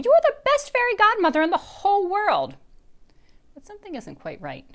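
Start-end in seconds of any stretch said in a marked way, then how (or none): 0.0-2.6 delighted squeal. Acted. Hugging him, delighted.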